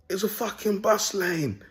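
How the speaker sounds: high, slow sneering driver; mock the rude driver's retort; imitating taxi driver. Man imitates a taxi driver snapping at him, high and slow. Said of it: "and he goes".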